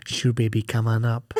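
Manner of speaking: a hint of a smooth lover inviting her up; lightly voiced seductive Johnny. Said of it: "He says it like that".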